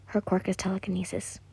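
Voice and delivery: quick, whispered side note; whispered aside. Real, not acted. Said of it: "whispered aside".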